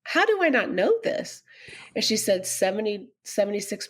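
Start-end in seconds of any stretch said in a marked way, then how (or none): 0.0-1.4 high-pitched, stunned question; astonished disbelief. Retold or imitated. Widow recalling her shock at the statistics, astonished.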